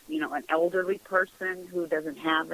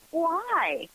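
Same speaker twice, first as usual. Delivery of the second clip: surprised why; 10 semitones higher than usual. Retold or imitated. Puzzled reply to coworker's warning.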